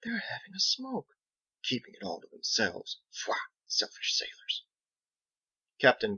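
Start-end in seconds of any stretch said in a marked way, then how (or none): 0.0-4.6 soft, hushed grumbling; whispering masons. A narrator's reading. Masons whispering about the sailors' smoke, hushed and soft. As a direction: mutter enviously under your breath. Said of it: "started whispering".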